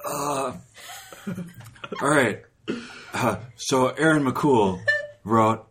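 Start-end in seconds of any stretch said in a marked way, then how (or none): none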